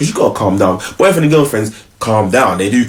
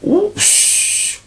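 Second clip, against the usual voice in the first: hushed shh; 4 dB quieter than usual. Retold or imitated. Hushing sound.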